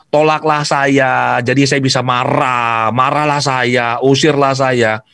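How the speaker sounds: slow, drawn-out mock plea; mock message. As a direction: spell out the hidden message slowly, ironically. Voicing the negative vibration sent.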